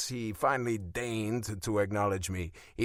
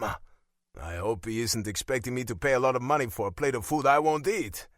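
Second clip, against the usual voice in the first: ordinary delivery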